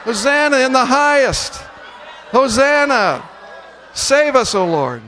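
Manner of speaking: high, loud festive cries; joyful shout. Real, not acted. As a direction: keep shouting praise with the congregation. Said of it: "Keep going".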